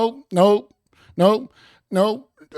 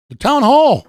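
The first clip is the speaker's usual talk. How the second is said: loud announcement; 5 semitones higher, 6 dB louder than usual. Acted. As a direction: announce the town hall louder and higher. Announcing arrival on stream.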